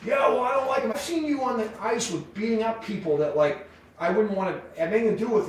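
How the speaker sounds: high whiny offended retort; high mock of the offended player; offended man. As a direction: defend yourself, hurt and pitched high. Offended man justifies his fear.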